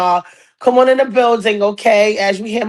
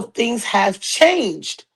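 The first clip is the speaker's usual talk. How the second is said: stretched out, slow and weighty; slow dramatic emphasis; much slower than usual. Acted. Host hinting at the feud, slow and weighty. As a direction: drag it out for drama.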